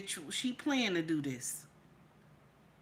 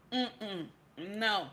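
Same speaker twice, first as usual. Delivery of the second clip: ordinary talk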